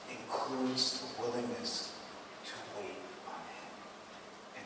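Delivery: a quiet, deliberate close; slow and hushed. Shown as a demonstration. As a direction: let each word settle. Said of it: "slowed, hushed emphasis".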